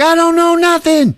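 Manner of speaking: high-pitched hammed-up denial; mock panicked protest. Maid protesting her arrest, mock panicked protest. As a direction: squeal it like a wrongly arrested maid.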